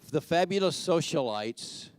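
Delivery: "fabulous" lifted high in pitch; emphasis on fabulous. Real, not acted. Announcer passing on the socialites' requests, heavy emphasis on fabulous. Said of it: "with a heavy emphasis on the word fabulous".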